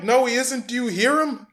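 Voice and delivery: high-pitched incredulous protest. Acted. Disputing claims that the quarterback is tough, high incredulous voice. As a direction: squeak out the disbelief. Arguing back at those calling him tough.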